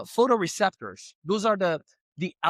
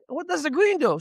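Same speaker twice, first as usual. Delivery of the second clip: puzzled husband; 10 semitones higher, 4 dB louder than usual. Retold or imitated. Husband puzzled questioning painter.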